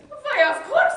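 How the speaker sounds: high, loud, gleeful "of course"; gleeful assurance. Acted. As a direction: cry it out with delight. Father assures suitor gleefully.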